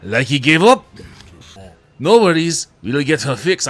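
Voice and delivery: loud, drifting French-ish mechanic voice; vague French accent. Acted. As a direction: play the village mechanic, wobbly French accent. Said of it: "I don't even know what accent I'm doing anymore".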